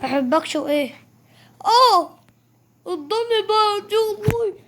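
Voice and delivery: ordinary talk, nothing marked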